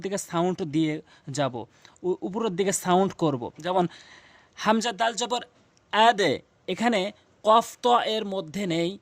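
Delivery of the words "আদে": আদে with a short "e" bounce on the d; downward qalqalah. Shown as a demonstration. Teacher explaining the letter dal, downward bounce on আদে. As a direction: push the echo of আদে down to "e". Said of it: "এটা নিচের দিকে sound".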